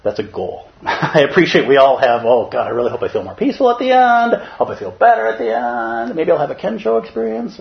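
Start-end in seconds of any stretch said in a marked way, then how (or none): 2.2-7.6 high hopeful meditator; wishing aloud for peace; hopeful inner voice. Retold or imitated. Meditator voicing hopes before Zazen, high and wistful.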